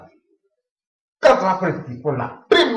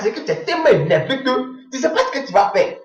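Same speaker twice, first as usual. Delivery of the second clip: ordinary talk, nothing marked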